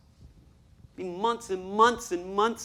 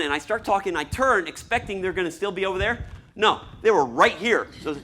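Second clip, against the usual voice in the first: ordinary talk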